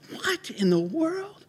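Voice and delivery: high, drawn-out astonishment; stunned disbelief. Retold or imitated. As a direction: stretch it out, high and amazed. Stunned on hearing baby cry.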